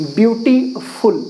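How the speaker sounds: slow, syllable by syllable: beauty-full; split into syllables. Shown as a demonstration. Teacher showing students the syllables, slow and split. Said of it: "दो part में divide कर सकता हूँ".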